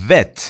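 "Vet." with a clear buzzing V, not a W; clear V sound. Shown as a demonstration. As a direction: teeth on lip for the V in "Vet.".

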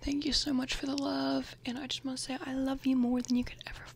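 soft breathy whisper, close to the mic; ASMR whisper. Real, not acted. Vlogger whispering through her bag contents, ASMR style. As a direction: whisper like sharing secrets with a friend.

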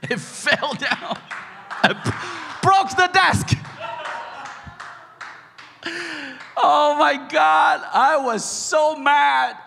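high, drawn-out outraged shout; hammed-up fury, slow and very loud; exasperated shouting. Dad reliving the broken desk, exasperated shouting.

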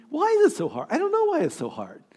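高声无奈地问; exasperated cry. Real, not acted. Preacher exasperated.

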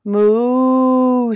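long, loud moo; cow mooing. A narrator's reading. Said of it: "said Cow".

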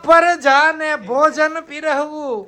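slow, high, grand proclamation; hammed-up regal announcement; mock royal decree. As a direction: declaim it like a pompous king.

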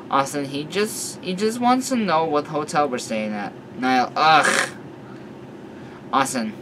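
usual speaking voice

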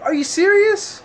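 shrill high disbelief; incredulous. Retold or imitated. Retelling girlfriend's refusal, squeaky high disbelief. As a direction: hit the top of your voice in shock.

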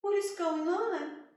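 high, loud, anxious father; father alarmed for his daughter; worried father. Acted. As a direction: fret over his daughter.

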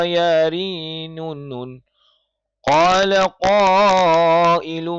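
melodic chanted recitation, letters spelled out in tune; Quran recitation. Acted. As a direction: chant each letter then the verse, slow and measured.

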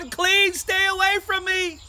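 acted outcast's cry; yelled warning. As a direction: call it out to keep passersby off. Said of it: "I have to yell out".

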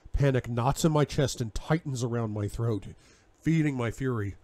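ordinary delivery, nothing marked

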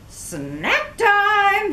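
high, gleeful crow; crow exclaiming. Acted. Crow gloats at spotting Katisha, high and gleeful. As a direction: gloat at the prey. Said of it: "he exclaimed".

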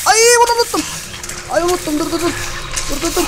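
high alarmed cry; sudden panic. Real, not acted. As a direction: yelp as you remember too late. (ani panikle tiz ses)

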